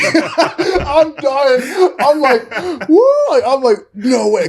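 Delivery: losing it at the coach's threat; laughing hard. Real, not acted.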